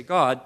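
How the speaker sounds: "God" said loud and emphatic; stress on GOD. Shown as a demonstration. Preacher showing the emphatic word, "God" punched hard. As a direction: hit "God" like thumping the table. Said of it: "you hit the table or the counter".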